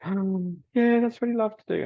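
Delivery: high-pitched, teary imitation of a grieving man; imitating bawling. Retelling a grieving dog owner, high imitated sobbing voice. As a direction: mimic him choking up through tears. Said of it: "was bawling".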